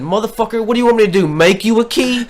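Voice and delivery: fed-up retold outburst at the coworker; retold angry rant. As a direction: let the frustration spill out as you replay it.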